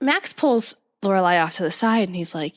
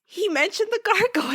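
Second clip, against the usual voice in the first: much higher, thrilled reaction; excited disbelief; 10 semitones higher than usual. Acted. Host reacts to Max mentioning the gargoyles, excited disbelief. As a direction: react as if it's astonishing news.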